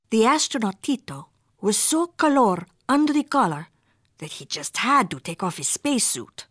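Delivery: usual speaking voice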